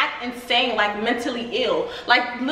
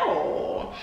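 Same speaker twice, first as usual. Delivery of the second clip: ordinary delivery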